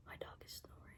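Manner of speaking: soft unvoiced whisper. Real, not acted. Telling viewers her dog is snoring, whispered. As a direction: whisper so the sleeping dog stays asleep.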